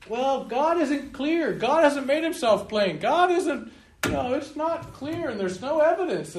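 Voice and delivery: high, slow whiny unbeliever's voice; mock complaint. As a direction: mimic the doubters' whining excuse.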